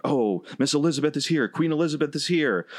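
high-pitched mock commentator excitement; gushing commentator impression; excited announcers. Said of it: "they're like".

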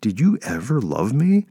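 high, drawn-out accusing question; wounded, pitch swooping up in disbelief; hurt accusation. A narrator's reading. Betrayed man confronts his old lover, hurt and accusing.

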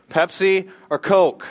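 playful emcee question; game show call. Acted. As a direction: stretch it out like a quiz host. Game host calls out question.

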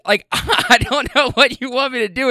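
laughing through the words; can't hold back a laugh at the absurdity; laughing disbelief. Real, not acted. To the lady about diarrhea, laughing in disbelief.

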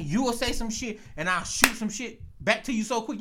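ordinary talk, nothing marked

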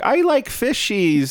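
put-on high, drawn-out little-kid voice; five-year-old voice. Imitating wife sounding like a five-year-old in Polish, childlike voice. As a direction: put on a small child's slow, high squeak. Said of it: "she sounds like a five-year-old".

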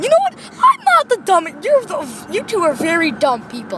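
hammed-up squeal of indignation; indignant outburst. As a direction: snap back in mock outrage. Acted quarrel, defending himself.

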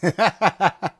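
a sudden high, loud laugh; loud burst of laughter. Real, not acted. Cohost bursts out laughing at the mom story. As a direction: crack up out loud.

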